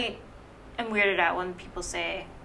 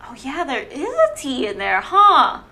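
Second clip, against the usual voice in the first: rising, higher 'huh?' of mild surprise; retold realization; 4 semitones higher, 6 dB louder than usual. Speaker recalls finding out about the T, mock surprised. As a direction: recall noticing it with a lifted, louder 'huh'. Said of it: "I was like, Oh".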